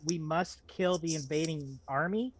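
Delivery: ordinary talk, nothing marked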